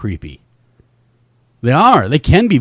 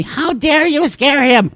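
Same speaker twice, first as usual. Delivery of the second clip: high-pitched scolding mother; mock indignant yell; 12 semitones higher, slower than usual. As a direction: imitate an outraged mother, slow and high. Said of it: "His mother yelled at me".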